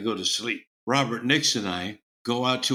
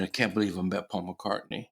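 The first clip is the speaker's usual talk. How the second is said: usual speaking voice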